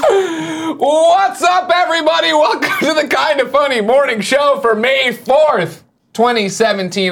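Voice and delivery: big shouted welcome. Acted. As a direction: shout the hello to the audience. Host opening the show.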